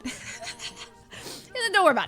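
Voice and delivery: laughing through the quote; giggling out the words. Real, not acted. Laughing while quoting stage manager.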